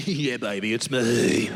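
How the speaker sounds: low, cocky come-on; sleazy seducer. Acted. God greeting Mary at her door, low sleazy come-on. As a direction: drop low and draw out the last word.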